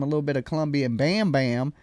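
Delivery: usual speaking voice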